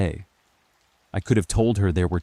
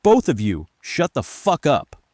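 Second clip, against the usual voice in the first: high-pitched angry outburst; snapping; 8 semitones higher, 6 dB louder than usual. A narrator's reading. Narrator turns on both Lindas, furious snapping. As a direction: order them silent, losing control. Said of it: "I snapped".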